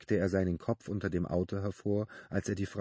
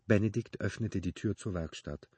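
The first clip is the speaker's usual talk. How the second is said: ordinary delivery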